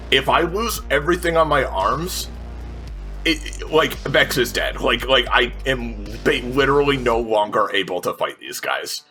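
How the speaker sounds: ordinary talk, nothing marked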